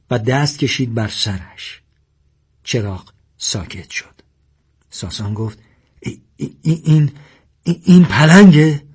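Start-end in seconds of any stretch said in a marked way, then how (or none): 6.0-8.8 stuttering build to a high, loud question; frightened stammer. A narrator's reading. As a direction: stumble repeatedly, then burst out in disbelief. Frightened man stutters before the leopard. (لکنت از ترس، سپس پرسش بلند)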